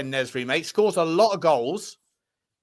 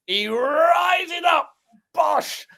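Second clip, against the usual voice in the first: higher, drawn-out build to a punchy burst; excited goal call; 8 semitones higher, much slower than usual. Acted. Host re-enacting the debut goal, excited commentary. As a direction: relive the header like a commentator.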